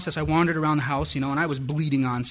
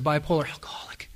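whispered. Retold or imitated. Confessing list to supervisor.

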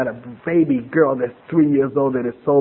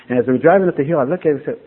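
normal talking voice

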